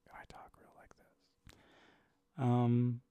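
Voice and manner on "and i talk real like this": soft unvoiced whisper; meditation voice. Shown as a demonstration. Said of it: "As I go into my meditation voice".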